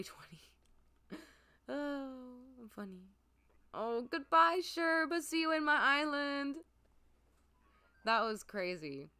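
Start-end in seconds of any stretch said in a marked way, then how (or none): 3.7-6.6 high, loud, cutesy goodbye; sing-song farewell. Pretend. Player saying goodbye to new villager Sherb, cutesy farewell. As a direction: call it out high and sweet.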